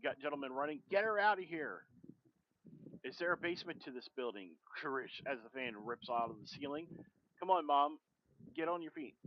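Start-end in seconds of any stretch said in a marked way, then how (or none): 0.9-1.8 urgent yell, higher and louder; acted shout. Man in the lobby yelling to clear people out, shouting. 7.4-9.2 louder, pressing plea; urgent call. Acted. Daughter urging her mother onto her feet, loud and pressing.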